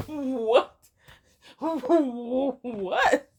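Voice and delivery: what, what, through laughter; bewildered and amused at once; laughing disbelief. Retold or imitated. Imagining a stranger asking this, laughing bewildered what. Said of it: "And you just like".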